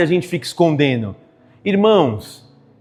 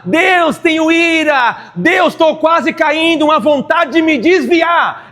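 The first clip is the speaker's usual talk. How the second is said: honest raw prayer; 7 semitones higher, slower than usual. Shown as a demonstration. Pastor showing the congregation an honest prayer. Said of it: "Seja você. Fale".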